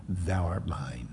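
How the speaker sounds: slow, hushed whisper; tender whisper. Retold or imitated. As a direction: drop low into a soft, slow whisper. God's tender words quoted from Isaiah.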